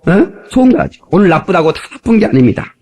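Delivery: ordinary talk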